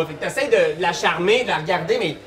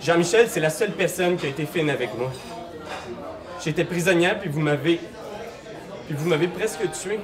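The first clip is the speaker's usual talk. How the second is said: soft, hurt, reproachful voice; wounded woman voice; 3 semitones lower, 6 dB quieter than usual. Acted. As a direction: speak low with grief. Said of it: "Et vous l'entendez dire".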